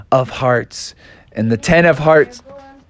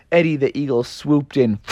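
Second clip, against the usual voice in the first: ordinary delivery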